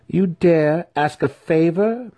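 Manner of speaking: high, slow, loud incredulous question; balking, affronted. A narrator's reading. Matron balking at her underling's request, affronted. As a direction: draw it out high, outraged at the nerve. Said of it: "Matron Sinife balked".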